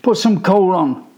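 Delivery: high, loud bossy command; bossy order. A narrator's reading. Danny orders Michael, loud and bossy. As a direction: boss your little brother. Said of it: "Danny orders".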